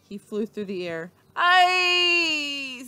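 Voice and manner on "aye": high, loud cry in midair; alarmed cry. A narrator's reading. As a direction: yelp as you fly off the pig. Leroy flying through the air.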